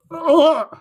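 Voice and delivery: disgusted groan. Retold or imitated. Crowd recoils at the ogres, laughing groan. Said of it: "everyone's like".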